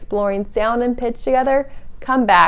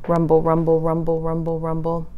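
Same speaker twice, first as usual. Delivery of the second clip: low, softer rumble; rumbling thunder; 5 semitones lower, 5 dB quieter than usual. Acted. Voicing far-off thunder, low and soft.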